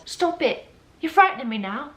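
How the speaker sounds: put-on high child's voice in a rough British accent; attempted British accent. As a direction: play a scared little British girl, muffled. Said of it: "I was trying to do a British accent".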